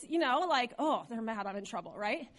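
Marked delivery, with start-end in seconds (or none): 0.8-1.9 low, hushed worry; dropped, soft voice of imitated dread; mock afraid child. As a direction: play a kid quietly realizing trouble is coming. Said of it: "I was afraid".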